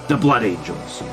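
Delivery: ordinary delivery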